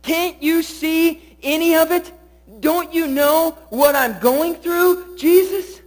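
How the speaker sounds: building from pleading to a high, loud cry at Jesus; anguished plea. Acted. Voicing a sufferer crying to Jesus.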